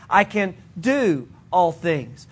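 "do" stretched out, set off by a pause; stress on DO. Shown as a demonstration. Preacher demonstrating varied emphasis, stress on "do". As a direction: lean hard on "do", slow delivery. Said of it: "A different emphasis would be".

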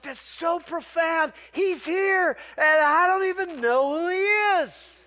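惊叹地慢慢说出; amazed exclamation. Retold or imitated. Pastor recalls his own thought.

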